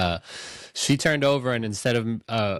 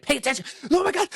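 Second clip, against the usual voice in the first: excited squeal; faster than usual. Retold or imitated. Husband urging his wife during the film, high excited squeal. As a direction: nudge your wife, bursting with excitement. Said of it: "I kept tapping my wife".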